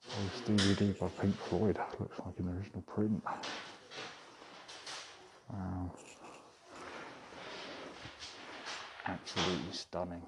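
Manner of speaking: hushed gallery whisper, muffled behind a mask. Real, not acted. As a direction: keep it low so staff don't hear. Whispering covertly inside gallery.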